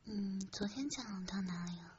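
normal talking voice

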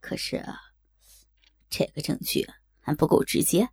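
hesitant, carefully considered objection; weighing words. A narrator's reading. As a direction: demur quietly, weighing each phrase. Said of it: "斟酌了一下道". (斟酌着迟疑地说)

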